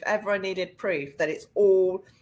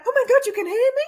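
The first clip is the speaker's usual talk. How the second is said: much higher, loud thrilled gasp of a question; excited disbelief; 8 dB louder, much faster than usual. Retold or imitated. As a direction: act amazed they answered. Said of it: "I behaved like".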